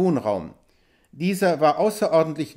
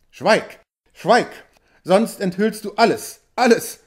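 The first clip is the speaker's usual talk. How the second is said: alarmed, louder cries, climbing higher on the last "alles"; frightened outcry; 3 semitones higher, 4 dB louder than usual. A narrator's reading. Chief to the hunter, alarmed he will reveal the treasure, crying out. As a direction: urgently hush him before he reveals too much. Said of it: "rief der große Bär erschrocken".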